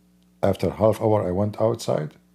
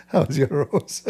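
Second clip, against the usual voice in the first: laughing; 4 semitones higher than usual. Real, not acted. Laughing while asking.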